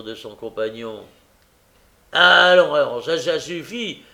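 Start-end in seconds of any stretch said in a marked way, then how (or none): 2.1-4.0 loud, pompous brush-off; mock dignity. A narrator's reading. Noé repousse Charlotte, avec une dignité hautaine et forte. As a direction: push her away with puffed-up dignity. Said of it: "avec dignité".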